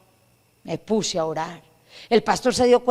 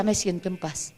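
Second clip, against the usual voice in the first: much softer and lower, at peace; relieved calm; 5 semitones lower, 10 dB quieter than usual. Retold or imitated. The freed woman telling the preacher she is at peace, soft. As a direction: breathe it out softly as if finally at peace.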